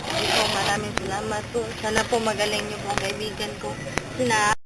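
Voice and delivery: a ghost explaining how to free her; low spirit voice. Acted. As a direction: speak as a calm spirit.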